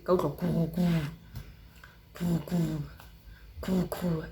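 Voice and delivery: soft low buzz imitating a vibrating phone; phone buzzing sound. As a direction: hum it like a phone on silent.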